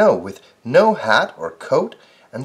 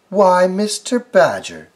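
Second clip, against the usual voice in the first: crying out; 7 semitones higher, 5 dB louder than usual. Acted. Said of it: "she cried".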